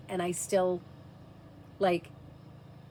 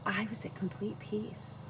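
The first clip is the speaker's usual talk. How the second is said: hushed whisper; 2 semitones higher, 5 dB quieter than usual. Real, not acted. Mother recalling near-death, whispering softly. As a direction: breathe the words as if at peace. Describing peaceful out-of-body state.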